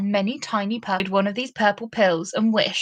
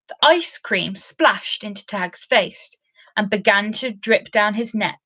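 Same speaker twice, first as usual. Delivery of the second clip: plain reading voice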